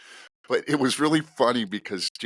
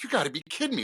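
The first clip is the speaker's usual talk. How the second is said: high, incredulous "you gotta be kidding me"; retold frustration, pitched up; exasperated disbelief; 8 semitones higher, much faster than usual. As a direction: let the disbelief pop up high. Exasperated at finding nothing.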